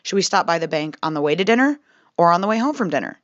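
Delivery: ordinary delivery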